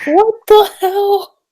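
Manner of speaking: very high, drawn-out squeal of disbelief; mock-tearful high shock; stunned disbelief. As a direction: wail it slowly, high and stunned. Stunned reaction to last panel.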